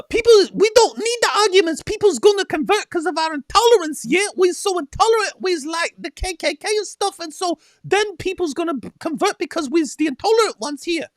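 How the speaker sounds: high-pitched hammed-up put-on accent; high, shouty mock-preacher accent; mock Ali Dawah accent. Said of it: "you see Ali Dawah going".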